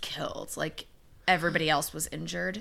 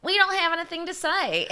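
high put-on voice, laugh creeping in; mock witnesses; 11 semitones higher, 4 dB louder than usual. People questioned by investigators, in a high mock-innocent voice. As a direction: squeak it out as a mocking imitation.

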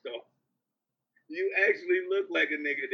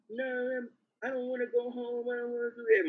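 higher-pitched retold protest; mock whining refusal; 8 semitones higher, faster than usual. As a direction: mimic someone begging to stay. Retold girl refusing to go home.

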